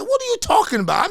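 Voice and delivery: loud, high-pitched incredulous question; retold disbelief. Agent replaying his reply to the paid customer, incredulous. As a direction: re-enact being floored, loud and high.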